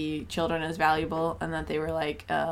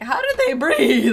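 laughing imitation; 8 semitones higher, 8 dB louder, slower than usual. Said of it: "Gabe was like".

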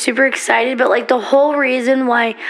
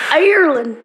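put-on Irish accent, pitched up; mock Irish accent; 5 semitones higher than usual. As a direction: say it with a playful Irish lilt. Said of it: "I wish I had that accent".

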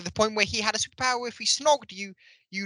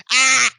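loud, sustained "ah" of exasperation; exasperated yell; 5 semitones higher, 14 dB louder than usual. Real, not acted. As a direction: burst out in a frustrated yell. Exasperated outburst about a disliked scene.

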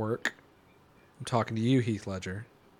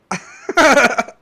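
high loud laugh; 12 semitones higher, 9 dB louder than usual. Real, not acted.

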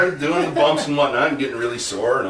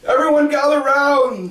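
much higher, louder call to the group; 13 semitones higher, 6 dB louder than usual. Retold or imitated. Trainer calls the whole class over to watch, loud call. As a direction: call everyone over across the gym. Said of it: "he goes".

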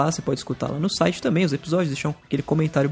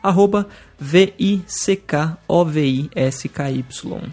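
slow, letter by letter; spelling it out; much slower than usual. Shown as a demonstration. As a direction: spell each letter out clearly and slowly. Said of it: "mas eu vou soletrar facinho".

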